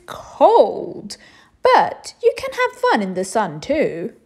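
high, loud, slow fussy king; a flustered king explaining patiently; dramatic king lecturing on seasons. A narrator's reading.

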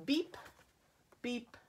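spaced horn toots, stepping down in pitch; receding beeps. A narrator's reading. Reader voices Blue driving off, beeps fading away. Truck drives off into distance.

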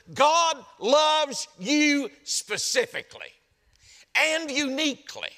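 high, slow, forceful proclamation; emphatic preaching. Real, not acted. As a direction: drive each word home, pitched well up. Driving the point home to congregation.

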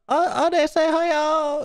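mock girly high voice, far above normal; high-pitched. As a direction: put on a high-pitched girl's voice. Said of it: "All high pitch and shit".

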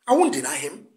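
normal talking voice

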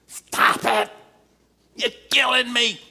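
put-on high, loud wailing protest like a small boy; childish yell. Preacher replaying himself as a boy yelling at mother, high and loud. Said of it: "And I was yelling".

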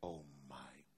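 slow, breathless whisper of dread; horrified whisper. Retold or imitated. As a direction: let it slip out in a whisper. Peter realizes his betrayal.

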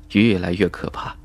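usual speaking voice

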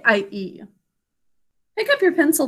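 ordinary delivery

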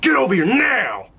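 guard ordering a bully over; commanding shout. Retold or imitated. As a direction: shout the kid over like a drill sergeant. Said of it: "I said".

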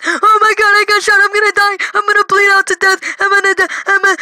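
high, squealed frantic worry; mock panic. Eight-year-old's thoughts after being shot, high mock panic. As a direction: imitate a kid panicking, high-pitched. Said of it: "what's going through my mind is".

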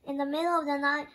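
ordinary delivery

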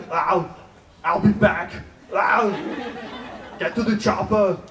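put-on Austrian action-star accent, lightly done; Schwarzenegger impression. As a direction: growl it like Arnold in a movie. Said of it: "with a shitty accent".

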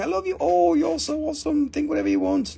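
high, sugary reassurance; smooth it over sweetly after the joke; placating the viewers after the religion joke. Acted.

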